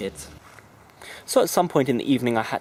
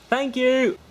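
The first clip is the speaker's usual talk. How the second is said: put-on kid thanking; 13 semitones higher, 5 dB louder than usual.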